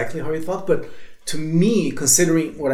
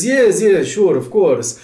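louder, higher retold yes; eager agreement; 6 semitones higher, 6 dB louder, slower than usual. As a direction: imitate an emphatic, ready agreement.

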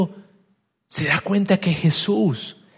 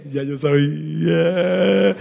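drawn-out, knowing aside; sarcastic; much slower than usual. Acted. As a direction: stretch it out slowly, slyly sarcastic. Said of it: "Jesús es el rey del sarcasmo". (sarcasmo arrastrado, de sabelotodo)

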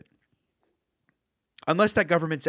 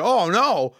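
mock dismay; 5 semitones higher, 5 dB louder than usual. Acting everybody's alarm at urgency.

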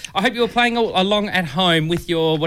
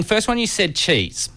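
ordinary talk

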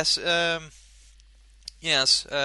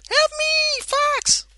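very high, tiny cry for help; squeaky Slippy voice; 7 dB louder, slower than usual. Pretend. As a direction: squeal it like a panicked frog.

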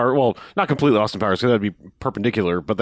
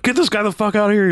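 panicked, much higher squeal; freak out mode; 12 semitones higher, 4 dB louder than usual. Retold or imitated. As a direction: lose it in a high-pitched panic. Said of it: "he goes into immediate freak out mode".

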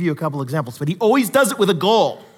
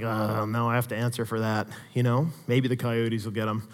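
mock sheepish mumble; 7 semitones lower, 8 dB quieter than usual. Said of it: "And I was like".

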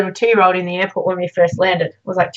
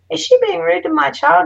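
a higher, rising mock of a suspicious mum; wary mother; 9 semitones higher than usual. Doubtful mum asking if the girl is rude, pitched up.

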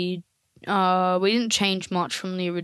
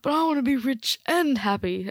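put-on higher old-man protest; raised-pitch Scrooge act; 5 semitones higher than usual. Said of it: "I'm an old man".

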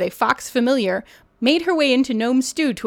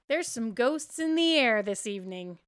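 ordinary delivery

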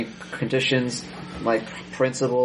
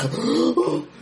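hammy stage actor gasp; 11 semitones higher than usual. Acted. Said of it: "by overacting as".

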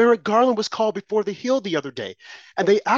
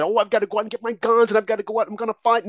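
mock frantic supporter; 4 semitones higher, faster than usual. Mocking imagined gun-rush supporter.

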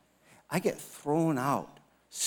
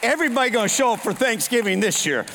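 high, loud gleeful cheer; loud high mock celebration; excited kids; 9 semitones higher, 10 dB louder than usual. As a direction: shout it like thrilled kids. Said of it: "My kids are like".

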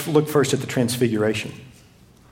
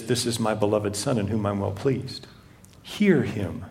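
lower, much softer voice of God from the cloud; 7 semitones lower, 8 dB quieter than usual. Acted. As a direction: let it come down hushed and low from above.

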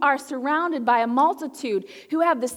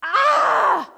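high loud burst of exasperation; exasperated outburst; sharp exclaim; 11 semitones higher, 7 dB louder than usual. Real, not acted. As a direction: let out a sudden frustrated cry. Sudden exclamation.

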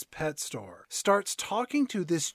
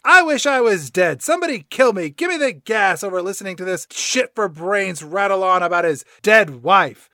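furious cat rant; 7 dB louder than usual. Retold or imitated. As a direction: spit out the rant at full volume. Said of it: "Grumpy Cat says".

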